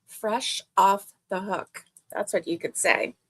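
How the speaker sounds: ordinary talk, nothing marked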